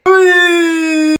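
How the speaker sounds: high, whimpering fake sob on oui; a child fake-crying to his mother for pity; fake crying yes. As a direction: pretend to cry to get sympathy.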